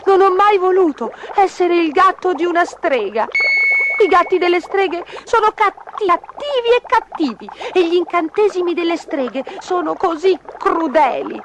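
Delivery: high, pleading kitten voice; little kitten Gobbolino. A narrator's reading. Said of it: "disse Gobbolino".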